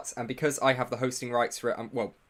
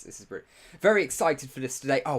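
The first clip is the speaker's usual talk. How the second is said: ordinary talk, nothing marked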